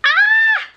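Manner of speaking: a loud, very high squeal of joy; delighted squeal. Real, not acted. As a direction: shriek with delight at chocolate. Finds chocolate in parcel.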